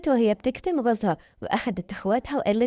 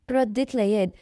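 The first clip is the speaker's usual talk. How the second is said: ordinary delivery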